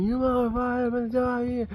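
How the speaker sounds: high-pitched mock yell of a raging driver; road-rage driver screaming, hammed-up; imitated screaming. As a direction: holler it on one high, held note. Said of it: "He's like screaming".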